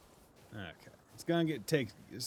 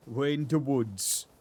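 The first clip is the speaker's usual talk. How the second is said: loud, slow comic voice; drawn-out, hammed-up funny voice; silly put-on voice; 6 semitones higher, 9 dB louder, slower than usual. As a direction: spell it out in a goofy character voice. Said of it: "talking like this".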